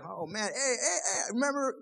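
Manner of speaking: ordinary talk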